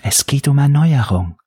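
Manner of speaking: high-pitched, submissive answer; submissive persona. A narrator's reading. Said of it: "beantwortete der Unterwürfige".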